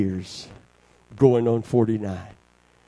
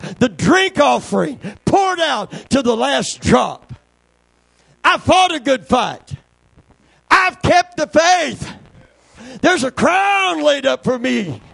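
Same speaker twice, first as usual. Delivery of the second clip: preaching at full force; 11 semitones higher, 7 dB louder than usual. Acted. Preacher shouting to the congregation about the crown.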